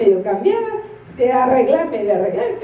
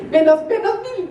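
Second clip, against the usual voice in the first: laughing deduction; 8 semitones higher than usual. Retold or imitated. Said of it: "sentí que esos puntos".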